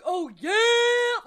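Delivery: loud, high hype shout imitation; mocking streamer yell. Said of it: "if you're in here like".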